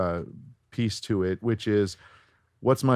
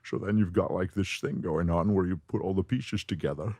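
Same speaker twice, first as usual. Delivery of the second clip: S's slurred toward SH, Scottish tinge; softer voice match with shushed S's; Sean Connery; 4 dB quieter than usual. Shown as a demonstration. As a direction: slip into a Scottish accent, S as SH. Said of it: "S's sort of sound more like S H's".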